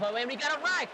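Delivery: sudden high shout; excited shout. Real, not acted. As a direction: yell out the crash. Commentator spots a crash.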